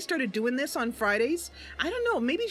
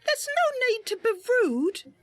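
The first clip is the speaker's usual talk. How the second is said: put-on high, indignant young woman voice; Mercy's retort; 11 semitones higher than usual. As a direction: talk back to the rude merchant.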